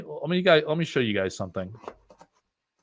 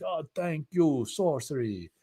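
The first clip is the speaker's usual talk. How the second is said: slow, softer, terrible put-on foreign accent; Andrea Modesti accent; 4 semitones higher, 7 dB quieter, slower than usual. Streamer mocks an artist's thanks in a bad foreign accent. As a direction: ham up a bad foreign accent, slowly and softly. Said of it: "It's a terrible accent impression".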